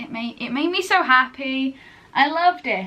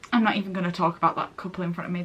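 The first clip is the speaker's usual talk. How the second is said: ordinary talk, nothing marked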